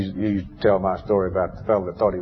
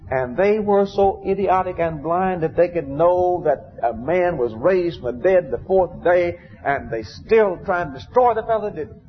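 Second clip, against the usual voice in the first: fiery preaching; 7 semitones higher than usual. Real, not acted. Preaching at full force.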